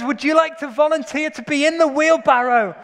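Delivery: high, loud showman's challenge; showman's call. Retold or imitated. Preacher voicing the tightrope walker seeking a volunteer, showman's call. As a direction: call it out to a whole crowd.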